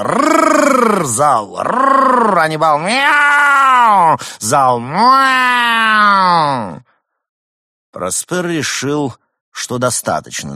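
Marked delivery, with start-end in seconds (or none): 0.0-1.0 high, loud mock growl. Leader growls. 1.6-2.4 high, loud crowd growl. Acted. Hall growls back. 2.8-4.2 high, loud yowled meow; shouted meow. Acted. Leader meows loud. 4.8-6.8 high, loud crowd yowl; shouted meow. Acted. Hall meows.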